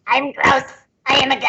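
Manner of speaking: rough, grungy goblin growl. Shown as a demonstration. Player shows cohosts her other voice, grungy goblin. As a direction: rasp like a chain-smoking, whiskey-soaked goblin. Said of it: "the grungy goblin voice".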